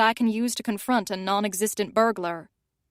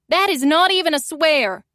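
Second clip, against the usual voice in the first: loud, higher yell from afar; yelling back; 6 semitones higher, 6 dB louder than usual. Acted. Fiona yells back from the stairs, loud distant yell. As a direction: yell it back over your shoulder. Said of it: "she yells back".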